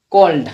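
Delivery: कोल्ड with a long rounded o, falling; model word cold. Shown as a demonstration. Teacher models कोल्ड to the class, clear long o. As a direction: lean on the o of कोल्ड. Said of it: "ओ असा उच्चार होतोय ना".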